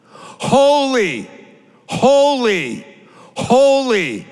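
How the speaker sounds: high, ringing holy calls; called-out holy. Acted. Seraphim calling to one another, ringing holy calls. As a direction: call each holy out high.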